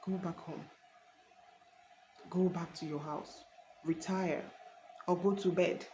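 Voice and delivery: hushed inner voice, softly prompting; imitated Holy Spirit. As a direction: give quiet, spaced-out instructions, well below talking volume. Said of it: "you heard the voice of the Holy".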